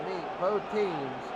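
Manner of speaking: usual speaking voice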